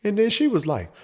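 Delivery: ordinary talk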